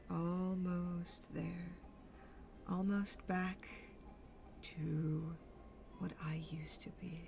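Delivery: slow, soft, breath-laden longing; hushed musing. Acted. Mauve savours being almost restored, slow and hushed. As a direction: draw it out, fading to a near whisper.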